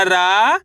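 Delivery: "रा" stretched with a rise; syllable by syllable. Shown as a demonstration. Teacher leads repeat-after-me, drawn-out rising "रा". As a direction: hold the long aa in "रा". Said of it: "मेरे साथ साथ इनको repeat करना है".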